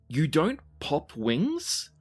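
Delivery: ordinary talk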